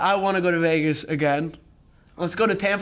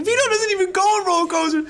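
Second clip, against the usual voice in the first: shocked disbelief; 11 semitones higher, faster than usual. Pretend. Imagined reply to a death text.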